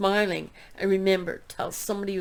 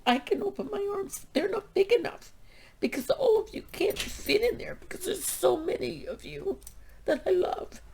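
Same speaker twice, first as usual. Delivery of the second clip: getting emotional; 13 semitones higher than usual. Real, not acted. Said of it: "I'm getting too emotional".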